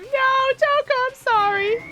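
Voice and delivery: high mock apology from the bear; the bear whining sorry way up high; squeaky mascot pleading. Voicing Monokuma dragging Toko.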